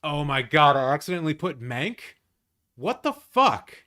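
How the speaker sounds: loud, high, disbelieving shout; shocked outburst. Real, not acted. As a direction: blurt it out in real shock. Shocked at own wrong pick.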